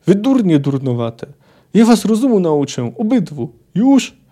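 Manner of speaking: loud, high, furious scolding; scolding yell. A narrator's reading. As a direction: holler at two brawlers, building to a peak. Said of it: "wrzasnęła jeszcze głośniej".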